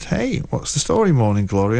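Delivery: a touch of American, rising on story; lightly voiced American young lady; a hint of an American woman's greeting; light American accent. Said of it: "Was that an American accent you put on there?".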